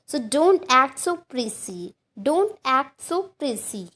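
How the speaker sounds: slow, careful teacher read of each word; slow model sentence. Shown as a demonstration. Teacher reading an example to Hindi learners, slow and clear. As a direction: say it slowly so students can repeat. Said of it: "teacher models example slowly".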